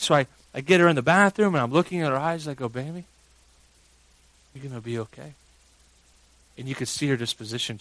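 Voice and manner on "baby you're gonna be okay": soft, hushed fatherly comfort; soothing reassurance. Retold or imitated. Dad reassuring his daughter in the bathroom, soothing hush. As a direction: bring it right down to calm a frightened child.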